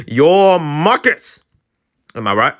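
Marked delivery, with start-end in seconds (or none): 0.1-1.4 slow emphatic character line; drawn-out teasing reveal; slow, louder drawn-out. Acted. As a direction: stretch it out, pleased.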